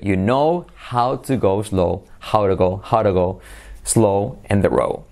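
slow, phrase-by-phrase model; slow breakdown. Shown as a demonstration. Teacher breaking the sentence down for learners, slowly. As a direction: break it into chunks, stretching the vowels.

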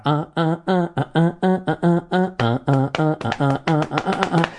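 high sung ah riff; guitar riff imitation. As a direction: vocalize the guitar line. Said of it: "que hace la guitarra".

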